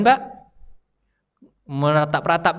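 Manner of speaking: ordinary talk, nothing marked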